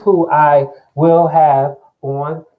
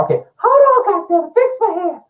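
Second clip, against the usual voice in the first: high falsetto doll voice, annoyed; puppet voice; 4 dB louder than usual. Acted. Mannequin Val fussing over her hair, high falsetto. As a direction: fuss about the hair as the doll.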